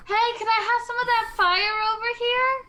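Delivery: high-pitched call across the room; acted shout. Character asks companions for fire, shouting out high. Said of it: "he's gonna shout out".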